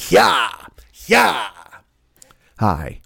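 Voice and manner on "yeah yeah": high, punched giddy-up yell; mock rider's cry. Podcaster urging his runaway stagecoach on, mock driving yell. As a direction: spur the horse on with short pretend yells. Spurring on the imagined runaway horse.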